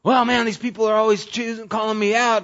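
complaining brawler. Retold or imitated. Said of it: "and it's like".

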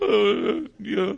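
high sulky grunt; pouty mumble. Retold or imitated. Pouting daughter answering her parent, sulky. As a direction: sulk it like a moping teen. Pouting little girl around the house.

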